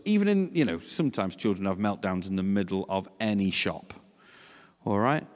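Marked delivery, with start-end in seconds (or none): none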